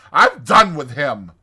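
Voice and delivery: loud, savage finality. A narrator's reading. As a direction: wash your hands of him angrily. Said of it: "said the Rat savagely".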